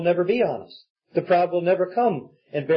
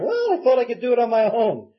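put-on higher-pitched child making an excuse; lightly voiced child; 5 semitones higher than usual. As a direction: play a kid explaining himself, pitched up. Child excusing itself, acted out.

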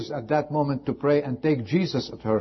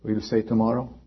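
ordinary delivery

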